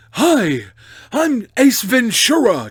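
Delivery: loud, high, drawn-out bad impression; hammed-up comic movie detective; Jim Carrey. Streamer mocking people's obvious impressions, loud hammed-up catchphrase voice. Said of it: "trying to do, like, a Jim Carrey".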